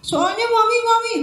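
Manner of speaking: put-on high, loud, whimpering boy; crying child. Boy crying out to his mother, high sob. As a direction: sob the name like a little boy. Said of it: "kata si Dino sambil nangis lagi".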